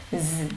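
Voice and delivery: a soft buzzing z with teeth vibrating. Shown as a demonstration. Teacher demonstrating the z ending, softly buzzing. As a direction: hum the "z" like a fly. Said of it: "Rung cái răng chút này".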